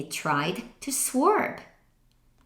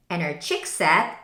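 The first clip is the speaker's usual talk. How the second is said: ordinary delivery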